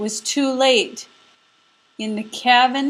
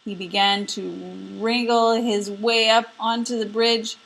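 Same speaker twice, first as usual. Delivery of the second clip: ordinary delivery, nothing marked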